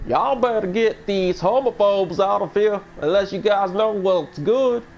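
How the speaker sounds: high, drawn-out Southern drawl; hammed-up Southern impression, pitched high; mock Southern accent. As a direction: give a joke warning in a Southern accent. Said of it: "making fun of the Southern accent".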